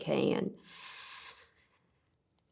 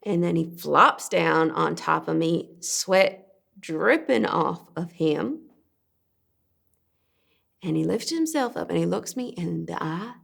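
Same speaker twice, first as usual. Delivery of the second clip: ordinary talk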